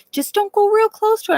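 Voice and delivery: high, singsong imitation of a cautious relative; mock warning voice. To the narrator, relatives warn her off, high mock voice. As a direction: mimic the relatives' breezy warning.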